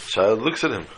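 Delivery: ordinary talk, nothing marked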